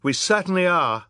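high, loud, fierce retort; fierce agreement. A narrator's reading. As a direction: agree fiercely, pitched right up. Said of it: "Violet agreed fiercely".

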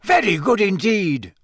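high, excited announcer's call; mild shout. A narrator's reading. Bagman hails Cedric's success, calling out high. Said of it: "Bagman was shouting".

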